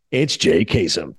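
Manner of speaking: low, slow sketch-character voice; retold comedy-bit voice, deep and slow; Jay Kasem. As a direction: drop low and drag it out as the son. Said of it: "bit about Casey Kasem and his fa-, and his son".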